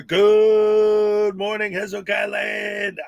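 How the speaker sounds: loud, stretched-out announcer-like greeting; drawn-out call. Real, not acted. Streamer greets the whole chat, drawn-out loud call. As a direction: call it out slowly to the whole crowd. Host calls out morning greeting.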